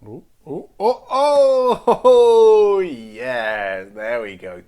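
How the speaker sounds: loud, high whoop sliding down into yeah; real excited shout; excited cheer. Real, not acted. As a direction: cheer a jackpot pull at full volume. Big card pulled from pack.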